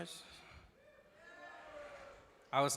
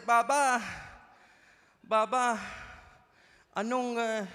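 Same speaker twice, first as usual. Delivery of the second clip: put-on higher little son with Filipino accent; 7 semitones higher, 3 dB louder, slower than usual. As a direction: call for dad, Filipino-accented and halting. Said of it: "speaks English with a Filipino accent".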